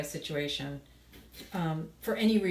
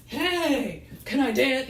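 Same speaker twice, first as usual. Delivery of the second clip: pushy guy at the club; 7 semitones higher, 7 dB louder, much slower than usual. Retold or imitated. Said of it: "a guy comes up to you and is like".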